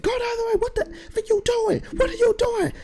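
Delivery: very high-pitched, frantic yelling; imitating yelling boss. Boss scolding worker on the stuck tractor, imitated high-pitched yell. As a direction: holler it as the exasperated boss. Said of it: "he started to yell at me".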